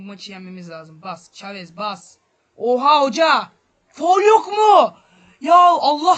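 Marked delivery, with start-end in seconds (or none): 2.6-6.2 very loud, high, slow yell; enraged cursing shout; furious shout at ref. Real, not acted. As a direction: scream at the referee for the foul. Screaming at the referee.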